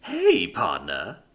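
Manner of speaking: slow, easy cheerful greeting; drawn-out friendly hello. Acted. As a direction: greet her cheerfully, taking your time. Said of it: "Max said cheerfully".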